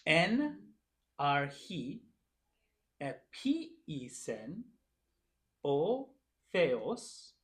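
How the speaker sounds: slow, word by word with pauses; slow demonstration reading. Shown as a demonstration. Teacher reading Greek aloud for the class to follow, slow and deliberate. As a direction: read it out very slowly, stretching each word. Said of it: "I'm gonna read it one more time".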